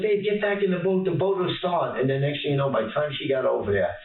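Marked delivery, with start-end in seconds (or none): 0.0-2.0 raised, higher call across the water. Retold or imitated. Husband calling to wife in the water, raised higher call. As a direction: call her back to the boat.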